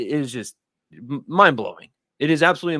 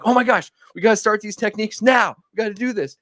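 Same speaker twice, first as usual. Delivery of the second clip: excited; 10 semitones higher than usual. Retold or imitated. Getting swept into the craze.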